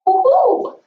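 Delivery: high, loud celebratory whoop; excited whoop. Real, not acted. Teacher cheering a successful price floor, excited whoop. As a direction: cheer like you just nailed it.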